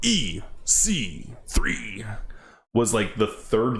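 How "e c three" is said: slow whispered name; slow hushed aside. Real, not acted. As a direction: breathe the name out in a hush. Whispered name of his pick.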